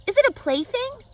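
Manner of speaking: high, loud curious piglet; Wilbur the pig. A narrator's reading. Wilbur asks eagerly.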